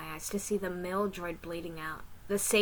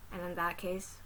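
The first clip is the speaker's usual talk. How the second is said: ordinary delivery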